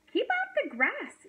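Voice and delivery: high, swooping storybook shout. A narrator's reading. Hank warning off a passing cowboy, shouting. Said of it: "shouted Hank".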